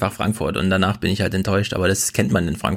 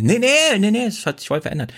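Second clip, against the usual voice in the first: mock kindergarten teacher; 12 semitones higher than usual. Quoting kindergarten staff insisting.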